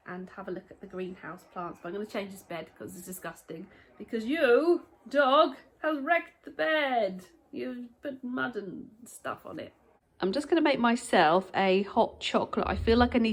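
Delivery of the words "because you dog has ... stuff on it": high, loud telling-off of the dog, slowing down; play-scold the dog for wrecking the bed; to the dog who muddied the bed, a high, slow mock scolding. Real, not acted.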